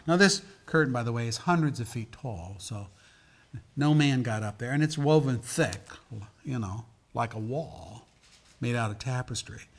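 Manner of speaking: normal talking voice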